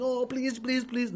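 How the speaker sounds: pleading high whine, half-mocked; mock begging. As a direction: ape a needy beggar asking God. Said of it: "you know, begging".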